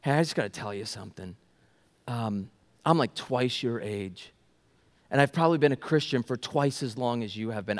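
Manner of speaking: low, soft, halting retelling of an emotional confession; choking up. Retold or imitated. Same lady catches up with him, quietly choked up. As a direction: gently imitate someone holding back tears. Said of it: "she's choking up here".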